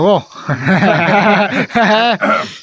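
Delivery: loud, high burst of laughing; real laughter. Real, not acted. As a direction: laugh out loud, rising in pitch. Laughing among friends in chat. (nui ring tak)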